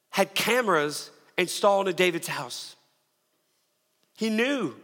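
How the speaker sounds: usual speaking voice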